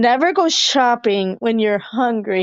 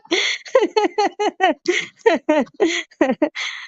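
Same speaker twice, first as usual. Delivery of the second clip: long high giggling fit; high giggle; 7 semitones higher than usual. Real, not acted. Laughing at her own joke about the door, high giggle. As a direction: let a high laugh roll out.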